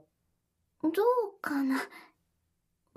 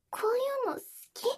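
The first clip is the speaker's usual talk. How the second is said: put-on high cute anime girl; 7 semitones higher, much faster than usual. Trying out the moe voice.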